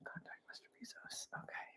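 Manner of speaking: breathy whispered echo; whispering. Real, not acted. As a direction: whisper the name back.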